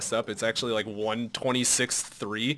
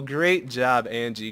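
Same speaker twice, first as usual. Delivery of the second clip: ordinary delivery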